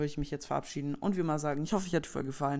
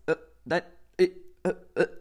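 choppy, loud stray syllables; superschlechte Verbindung; 2 semitones higher, 6 dB louder, faster than usual. Acted. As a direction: sputter like a call cutting out. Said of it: "du hast super schlechte Internetverbindung". (abgehackte Tonfetzen)

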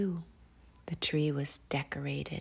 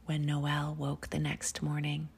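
ordinary delivery

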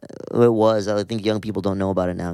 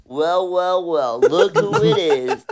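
smug villain gloat; 8 semitones higher, much slower than usual. Retold or imitated.

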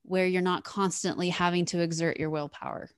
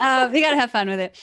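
repeated higher, louder and quick in a silly character voice; playful put-on voice; 6 semitones higher, 9 dB louder, faster than usual. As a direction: say it again as a fun character, high and loud. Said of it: "There's all sorts of characters in here".

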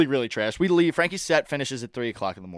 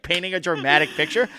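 ordinary talk, nothing marked

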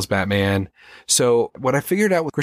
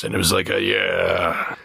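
slight low growl, chest puffed out; lightly growled but natural hero voice; Kevin Conroy's Batman; 3 semitones lower than usual. Shown as a demonstration. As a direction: puff out the chest and growl gently. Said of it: "There was a growl to it".

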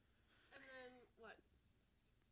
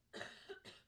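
coughing fit; 4 dB louder than usual. Real, not acted. Coughs and sees blood.

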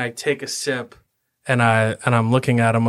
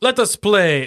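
boisterous; 6 semitones higher, 4 dB louder than usual. Acted. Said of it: "I'm trying to be boisterous".

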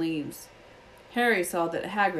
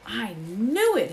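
ordinary delivery

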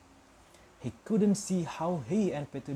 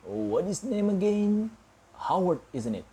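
mock forgetful; 6 semitones higher, 5 dB louder than usual. Petunia feigns forgetting the name.

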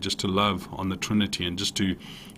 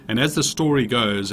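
ordinary delivery

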